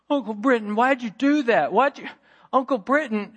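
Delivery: mock-wailing nephew; upset kid. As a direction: complain like a shocked little boy. Upset nephew thrown off tube.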